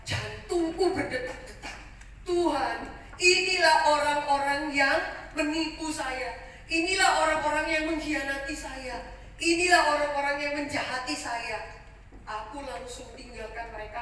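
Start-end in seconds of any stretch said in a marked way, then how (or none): 2.3-11.8 loud cry to God, pained; anguished prayer. Acted. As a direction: call out the betrayal to heaven. Joseph cries out to God.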